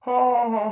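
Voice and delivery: hammed-up villain cackle; a short mocking villain laugh; evil laugh. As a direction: cackle at the little kid. Villain mocks the child.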